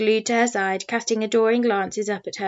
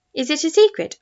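higher, wide-eyed question; boy agog at a secret; 6 semitones higher, 4 dB louder than usual. A narrator's reading. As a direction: ask with bated excitement. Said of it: "asked George agog".

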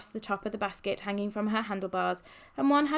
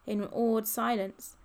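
ordinary delivery, nothing marked

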